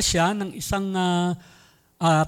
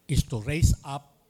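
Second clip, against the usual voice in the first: ordinary delivery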